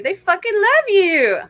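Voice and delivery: high, loud and slow, leaning on love; overjoyed declaration, stretched out; emphatic gush. Real, not acted. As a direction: gush it out with full conviction. Enthusiastic message for the viewer.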